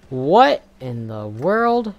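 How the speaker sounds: slow loud baffled question. Real, not acted. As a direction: drag it out in disbelief. Baffled at the game.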